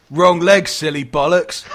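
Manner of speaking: put-on loud old-guy comeback, punched out; triumphant retort. Old guy mocking the zombie that bit him, loud scornful retort. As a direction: crow it at the zombie. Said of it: "he just looks around and he goes".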